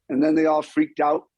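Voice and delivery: normal talking voice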